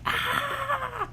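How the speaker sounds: high piercing mock scream; a sudden falsetto yell of anguish; imitated scream. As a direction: let out a short hammed-up scream. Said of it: "you just see Dexter screaming".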